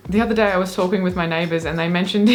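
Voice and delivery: quick read collapsing into laughter; giggling. Real, not acted. Reading about the neighbours overhearing, giggling. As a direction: break into a long laugh at the end.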